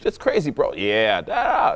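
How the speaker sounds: over-the-top reaction to boring small talk; animated. Shown as a demonstration. Coach acting out amazed chat about Boston, animated. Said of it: "the whole thing is very animated".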